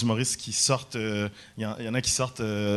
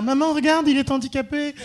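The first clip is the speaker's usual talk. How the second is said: put-on high-pitched kid calling to mum; pointing child; 14 semitones higher, 4 dB louder than usual. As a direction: pipe up like a child in the audience. Voices a child pointing him out.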